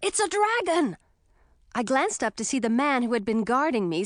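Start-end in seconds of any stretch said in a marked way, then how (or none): 0.0-1.0 alarmed call. A narrator's reading. Townsman calls out.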